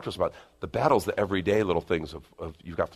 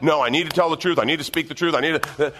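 self-justifying flesh; 6 semitones higher, 4 dB louder than usual. Retold or imitated. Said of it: "I can even justify it".

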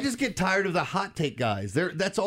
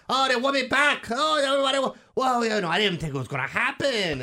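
high, excited Mad Dog-style whine, easing off; Mad Dog impression; 8 semitones higher than usual. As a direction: act the star overwhelmed at being wanted back. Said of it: "that's him".

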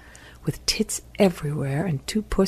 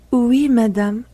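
put-on high, louder French accent; French-accented coo, pitched up; sexy French accent; 9 semitones higher, 6 dB louder, slower than usual. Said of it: "that sexy French accent drove us wild".

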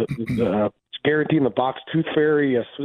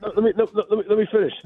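excited insisting; 9 semitones higher, faster than usual. Real, not acted. Caller insists on finishing his point.

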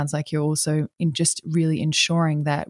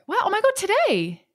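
real excited high voice. Real, not acted. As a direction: voice shooting up in delighted surprise. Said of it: "My voice just went up".

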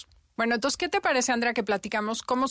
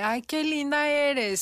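praising mother; 7 semitones higher, 3 dB louder, slower than usual. Retold or imitated.

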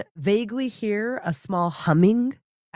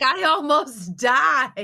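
cat voice; 8 semitones higher, slower than usual. Pretend. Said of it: "He's like".

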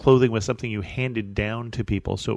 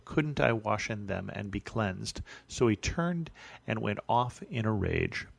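soft, even Bible reading, a little quieter than his talk; scripture reading; 6 dB quieter than usual. Acted. Pastor reading the Naaman story to his congregation, steady soft reading voice. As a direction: read the passage out steadily and softly.

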